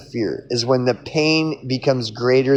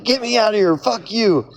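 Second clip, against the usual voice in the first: higher, mock-panicked yelp of a scared hero; a hammed-up freak-out, pitched way up; retelling a movie scene, a mock-frightened higher cry; panicked Neo; 8 semitones higher than usual. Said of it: "And then fucking Neo's like".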